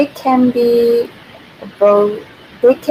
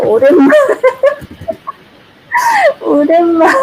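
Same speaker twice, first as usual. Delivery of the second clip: real giggles breaking into the word, higher; helpless laugh around the word; much faster than usual. Real, not acted. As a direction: crack up while saying it. Breaks up laughing at a viewer's comment.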